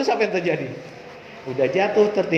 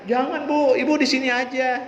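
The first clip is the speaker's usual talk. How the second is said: put-on pleading child; 7 semitones higher than usual. Child begging mother to stay.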